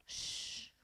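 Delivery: soft sigh then a whispered shh; quiet whispered shush to settle listeners. Real, not acted. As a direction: hush the room gently. Shushing during a read-aloud.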